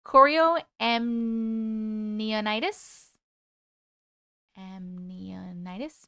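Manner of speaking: "chorioamnionitis" sounded out very slowly, drawn out; unsure pronunciation. Real, not acted. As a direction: stumble slowly through "chorioamnionitis", then retry "Amnionitis". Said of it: "I pronounced it wrong entirely".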